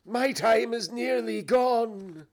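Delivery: high, slow, loud ghostly wail; urgent cry. Acted. Ghost warns Scrooge time is short, crying out. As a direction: stretch it out as an urgent warning. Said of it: "cried the ghost".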